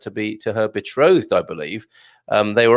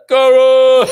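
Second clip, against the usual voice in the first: high, loud call; called-out greeting; 12 semitones higher, 10 dB louder than usual. Real, not acted. Host calling out to a viewer, high and loud. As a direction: hail it across the room.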